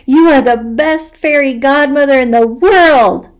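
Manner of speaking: high, loud gratitude; delighted. Acted. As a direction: gush with joy. Hugging him gratefully.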